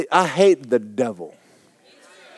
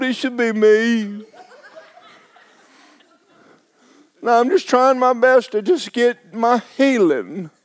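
demo of his prayer; 6 semitones higher than usual. Shown as a demonstration. Preacher showing the congregation his complaining prayer, mildly whiny. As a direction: whine to Jesus in a mild mock self-pity. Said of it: "this is what I do".

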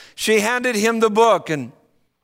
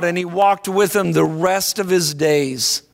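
ordinary delivery

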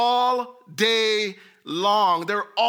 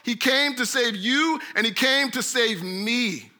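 usual speaking voice

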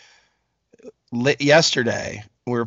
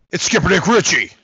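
game show guest announcement; announcer intro; 3 semitones higher, 3 dB louder than usual. Acted. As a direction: present him like a game show host. Host announces second guest.

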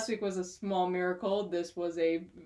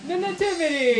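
mock-grand announcement; 9 semitones higher, 10 dB louder than usual. Reading the title after a long drum roll, mock-grand announcement. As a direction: wail the title out with hammy fanfare.

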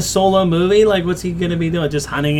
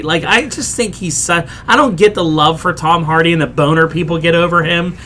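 usual speaking voice

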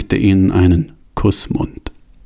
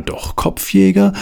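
amused punchline; 11 semitones higher than usual. A narrator's reading. Punchline of the list.

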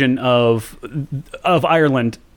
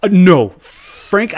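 exasperated correction; 6 semitones higher, 6 dB louder, much slower than usual. Real, not acted.

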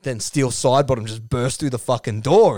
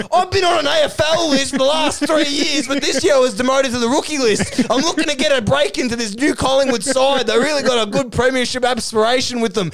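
high-pitched, earnest pitch for himself; acted hopeful player's spiel; eager pleading; 12 semitones higher, 3 dB louder than usual. Acted eager self-introduction.

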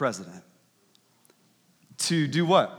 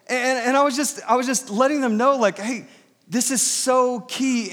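calling out; 7 semitones higher, 3 dB louder than usual. Retold or imitated. Pastor letting the visitors in his driveway know, calling out. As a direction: shout it kindly down a driveway, pitched high. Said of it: "I was talking like this".

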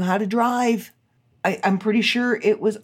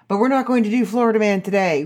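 ordinary talk

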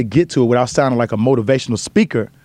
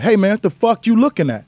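angry grandson; 8 semitones higher than usual. Retold or imitated. Grandson confronts the guys.

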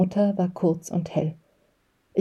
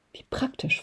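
angry hiss; 7 semitones higher, 8 dB quieter than usual. A narrator's reading. Mother spitting back at father's China excuse, hissed. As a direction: hiss it softly, mostly on the breath. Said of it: "fauchte meine Mutter".

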